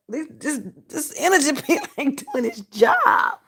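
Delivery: high, loud laughing whine; laughing complaint. Real, not acted. Complaining to viewers his pill failed, laughing whine.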